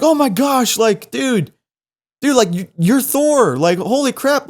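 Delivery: high-pitched imitation of amazed fans; starstruck gushing.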